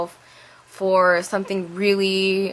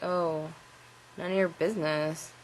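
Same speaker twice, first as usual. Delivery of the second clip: quiet; 2 semitones lower, 9 dB quieter than usual. Retold or imitated. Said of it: "we talked like that to each other".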